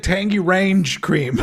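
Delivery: "Range" said as one syllable, like a mountain range, with a laugh; misread product name. Shown as a demonstration. Explaining what the folded packaging said, amused misreading. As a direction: read "Range" without the opening "O" of orange. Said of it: "and so it said".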